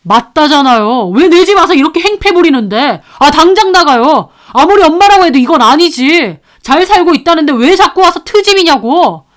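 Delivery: loud, high screaming daughter-in-law; daughter-in-law shouting at her mother-in-law. Acted. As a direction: yell her out of the house. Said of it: "저한테 소리를 지르는 겁니다".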